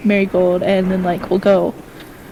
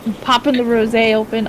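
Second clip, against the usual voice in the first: ordinary talk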